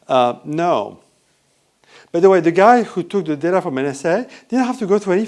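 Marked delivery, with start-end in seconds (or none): none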